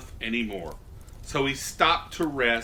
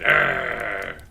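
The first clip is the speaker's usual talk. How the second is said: wolf growl; 7 semitones lower, 4 dB louder than usual. A narrator's reading. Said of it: "the wolf showed his teeth".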